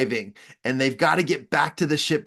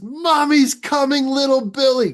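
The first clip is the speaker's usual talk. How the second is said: mock mommy voice; 11 semitones higher, 5 dB louder than usual. Rocket Man answering the commander as his mommy, hammed-up. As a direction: coo it like a fussing mom.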